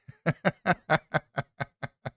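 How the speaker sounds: bright high burst of laughter. Real, not acted. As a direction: laugh at your own joke. Laughs at own radio voice.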